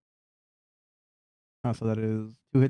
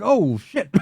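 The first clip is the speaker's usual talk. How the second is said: high mock dismay; startled character; 9 semitones higher than usual. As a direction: act out a caught-off-guard figure, pitched up. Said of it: "He's like".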